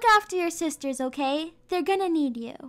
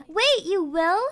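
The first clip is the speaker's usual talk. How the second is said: normal talking voice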